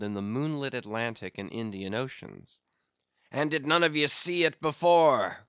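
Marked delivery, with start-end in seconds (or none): 3.3-5.4 raised, louder captain's call; hailing cry. A narrator's reading. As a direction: hail the men perched all around you. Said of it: "cried Ahab, hailing the perched men all around him".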